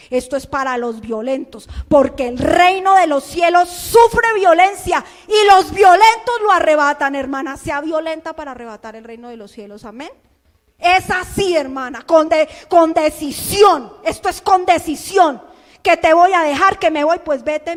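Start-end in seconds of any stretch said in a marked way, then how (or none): 1.9-7.2 loud, slow preaching proclamation; forceful preaching shout. Real, not acted. Preacher exhorting the sister. 10.8-17.8 forceful preaching. Real, not acted. Preacher urging decision.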